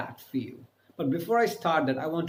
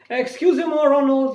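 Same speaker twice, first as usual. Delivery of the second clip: calling to a friend; 11 semitones higher, 8 dB louder than usual. Shown as a demonstration.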